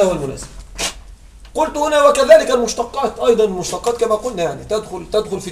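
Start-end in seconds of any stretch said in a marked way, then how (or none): none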